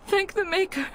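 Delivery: flooded with relief; mother's gasp of relief as Teagan agrees; relieved gasp. Acted.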